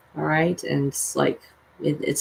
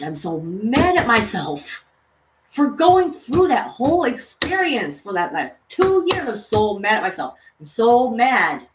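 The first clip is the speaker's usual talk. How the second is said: raised, heated venting about the past; real angry rant; 2 semitones higher than usual. Real, not acted. Vlogger venting at viewers, angrily. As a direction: let the anger out, fast and raw. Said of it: "Excuse my rant".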